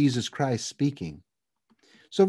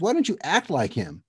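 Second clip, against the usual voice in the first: ordinary talk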